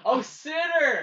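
drawn-out, high delighted oh; delighted realization. Real, not acted. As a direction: slowly realize the joke, thrilled. Sudden realization of the pun.

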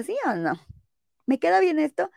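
reluctant approval. Retold or imitated. Friend grudgingly answering if the outfit fits, hesitant. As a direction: stall before admitting it. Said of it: "el tonillo es como de".

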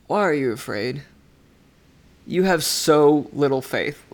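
lightly groggy, unimpressed murmur; a touch of sleepy reproach; Jesus, just woken. Acted. Just shaken awake, turning over to the disciples, mildly groggy. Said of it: "just turning over his shoulder and going".

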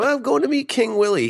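high, loud mimicked line; mock high voice. Host mocking cop off to meet gangster, high voice. Mimicking the cop's dim explanation.

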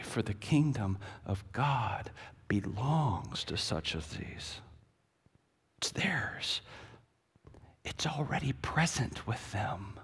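soft, slowing to a whisper; hushed. Acted. Preacher revealing the kingdom to congregation, whispered. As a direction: draw them in with a secret. Hushed climax of sermon point.

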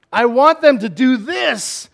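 high, loud frustrated demand; exasperated parent. Acted. God as parent of teenagers, loudly exasperated. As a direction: push it out in exasperation. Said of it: "Isn't that what God's saying?".

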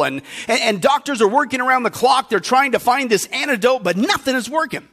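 ordinary talk

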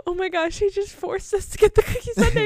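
high, squealing mock gasp of shock. Retelling own shocked reaction.

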